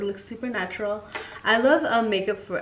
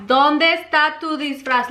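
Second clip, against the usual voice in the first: louder, slower repeat of the question; 6 semitones higher, 7 dB louder, slower than usual. Retold or imitated. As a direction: shout it slowly so he hears. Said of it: "Like, loud as fuck".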